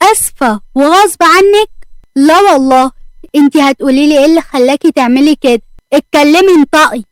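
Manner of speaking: loud, incredulous scolding; angry demand. A narrator's reading. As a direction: throw the apology back and order an answer. Boss rejects apology, demands answers.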